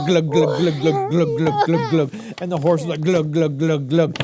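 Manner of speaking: low glug glug gurgling; drowning gurgles. Retold or imitated. Uncle acting Pharaoh and horse drowning, mock gurgle. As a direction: gurgle as you sink.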